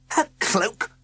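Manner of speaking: high startled exclamation; exclaiming. A narrator's reading. Sir Quincy startled by the cloak, high exclamation. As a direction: catch on it in surprise. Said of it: "exclaimed Sir Quincy".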